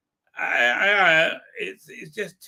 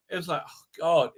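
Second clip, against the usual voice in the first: ordinary talk, nothing marked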